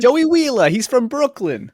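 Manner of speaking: put-on Brooklyn accent. Mocking the dub accent.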